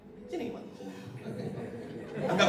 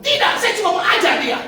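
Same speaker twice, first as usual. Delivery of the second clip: insistent retort; 10 semitones higher, 5 dB louder than usual. Retold or imitated.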